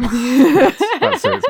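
high, quick excited exclamation. Real, not acted. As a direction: squeal it out in recognition. Excited recognition of Yuri Geller.

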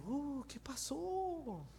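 soft, high, drawn-out wondering; hushed, slow mock puzzlement; mock dismay. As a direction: stretch it out high and small. Mock reaction to the weak confession.

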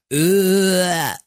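drawn-out disgusted retching noise; mock gagging. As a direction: gag at a revolting topping. Reacting to cranberries on pizza.

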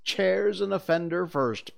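put-on brisk boyish command; giving orders. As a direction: list the jobs like a captain. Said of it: "Peter ordered".

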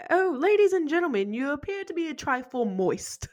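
high, put-on genteel greeting; castle owner impression. Castle owner eyeing soaked guests, high mock-polite greeting. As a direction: imitate a courteous host's understatement.

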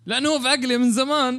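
high, loud playful reply; excited. Real, not acted. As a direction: tease him, loud and high.

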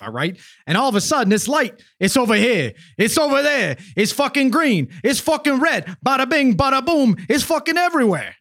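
mock mobster raving about the light; New Jersey accent. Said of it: "representing the wonderful diversity of New Jersey accents".

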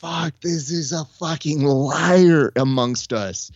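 loud, slow, drawn-out accusation; hammed-up betrayed fury; mock outrage. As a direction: denounce a traitor, stretching it out slowly. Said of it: "So I was so mad".

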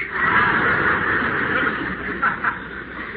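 breathy delighted chuckle; pleased, bashful laugh; giddy laugh. Acted. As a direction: laugh at your own little joke. Doctor laughing at his own joke.

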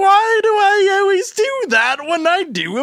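very high, loud British street urchin; Dickensian newsboy. Acted. Actor lamenting his accent, shrieking as British newsboy. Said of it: "going back to Dickensian newsboy".

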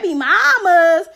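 celebrity impression in mock outrage.

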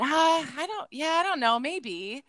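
high-pitched, wavering uncertainty, dropping on "maybe"; retold noncommittal reply, pitched high; hesitant. Retelling own doubtful reply to suggestion.

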